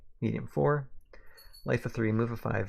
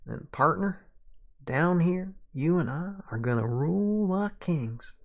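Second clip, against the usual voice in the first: higher, grand Southern sheriff; Southern accent; 6 semitones higher than usual. Acted. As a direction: promise a kingdom in a stretched Southern drawl. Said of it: "I can do the accent".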